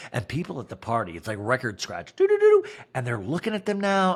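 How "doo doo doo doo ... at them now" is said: ordinary talk, nothing marked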